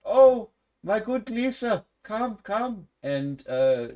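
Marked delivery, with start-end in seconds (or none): 0.1-2.9 much higher excited woman's voice; peasant woman. Acted. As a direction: gush over the knight in armor. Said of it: "a peasant woman ... says".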